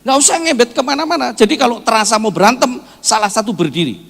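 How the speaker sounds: high, loud instruction to couples; forceful preaching. Real, not acted. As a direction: drive the advice home loudly.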